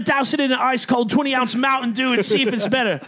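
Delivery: high, loud Crocodile Hunter; excited wildlife host plan; Steve Irwin voice. Retold or imitated. Irwin voice continuing the bit.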